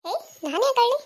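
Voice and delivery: high-pitched, taken-aback retort; indignant denial. Acted. Friend asked by him if she was crying, indignant denial. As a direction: toss it back in high disbelief.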